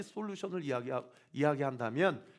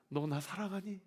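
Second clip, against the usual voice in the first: tender question; 3 semitones higher, 6 dB quieter than usual. Retold or imitated. Jesus asks again.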